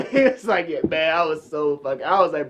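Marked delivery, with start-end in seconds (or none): none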